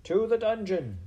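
high, loud crowd cry; mob shout. A narrator's reading. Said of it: "shouted the mice".

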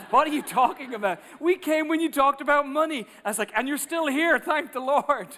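thrilled pastor laughing it off; excited surprise. Retold or imitated. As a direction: exclaim in joyful disbelief. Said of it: "I'm like".

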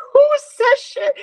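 high, loud laughing question; laughing disbelief. Real, not acted.

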